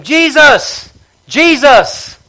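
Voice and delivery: high, loud cry for help. Retold or imitated. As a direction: call out desperately across the crowd. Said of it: "he starts crying out to him".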